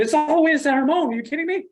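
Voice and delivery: exasperated mock protest. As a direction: protest like it's absurd. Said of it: "And so I'm like".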